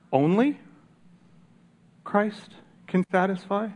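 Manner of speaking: high rising skeptical echo; doubtful question. Real, not acted. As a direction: question it, as if hard to believe. Questioning the quote just given.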